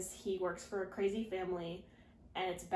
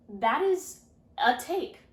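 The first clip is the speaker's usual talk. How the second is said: incredulous; 6 semitones higher, 7 dB louder, slower than usual. Real, not acted. Reacts to Cass's odd choice.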